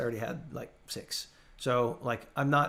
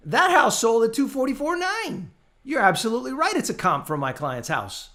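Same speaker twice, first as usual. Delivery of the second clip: higher, louder, heated push-back; exasperated retort; 6 semitones higher, 6 dB louder than usual. Retold or imitated. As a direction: argue the numbers in disbelief, raising the voice. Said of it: "And I'm like".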